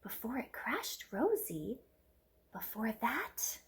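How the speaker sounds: slow secretive whisper; suspenseful whisper. Acted. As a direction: lean in and whisper to build suspense. Aunt builds suspense.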